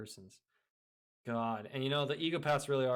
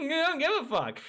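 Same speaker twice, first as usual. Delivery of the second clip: laughing; 13 semitones higher, 4 dB louder than usual. Real, not acted. Laughing at own point.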